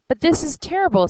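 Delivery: high, loud, fast cry of dismay. Acted. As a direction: blurt it out in alarm. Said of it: "Next is terrible".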